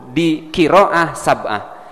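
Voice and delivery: ordinary talk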